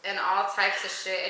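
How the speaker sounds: ordinary delivery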